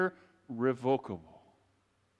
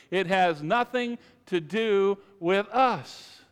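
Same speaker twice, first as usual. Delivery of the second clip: slow, loud, word-by-word for a group to join; slow emphatic repeat; 2 semitones higher, 4 dB louder, slower than usual. Real, not acted. Preacher leading the congregation to repeat it, slow and emphatic. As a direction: draw out "nothing" and "us" emphatically. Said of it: "Why don't you say it with me?".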